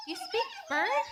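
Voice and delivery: put-on girl amazed someone speaks bird; surprised. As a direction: squeak it in amazed disbelief. Puzzled at talk of birds.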